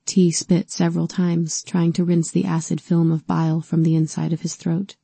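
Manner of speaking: ordinary delivery, nothing marked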